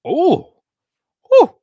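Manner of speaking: high, loud delighted ooh; thrilled little ooh; excited ooh. Real, not acted. Excited over a player rating.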